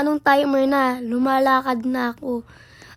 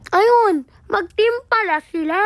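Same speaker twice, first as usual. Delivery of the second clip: surprised realization; 6 semitones higher, 6 dB louder than usual. Retold or imitated. Voicing imagined thought about players.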